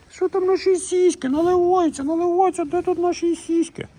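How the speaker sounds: put-on very high, squeaky baby voice; high-pitched baby-talk falsetto to a cow.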